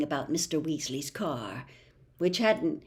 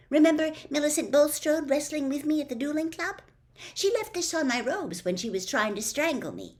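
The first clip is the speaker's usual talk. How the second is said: quick, clever schoolgirl laying out details; Hermione explaining; 7 semitones higher, faster than usual. A narrator's reading. Hermione instructing Harry and Ron, brisk and knowing. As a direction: rattle off the plan, sure of herself.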